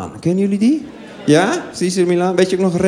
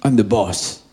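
big man's voice; 7 semitones lower, slower than usual. Retold or imitated. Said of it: "En hij zegt".

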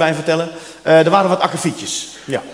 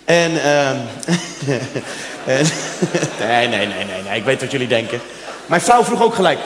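normal talking voice

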